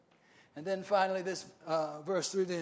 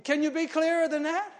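usual speaking voice

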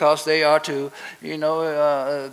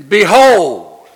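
proclaiming scripture; 11 dB louder than usual. Acted. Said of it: "And God said".

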